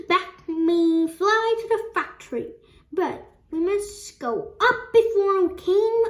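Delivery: high, loud and exuberant; loud, high character exclamation; excited urging. A narrator's reading. Wonka urging everyone upward in story.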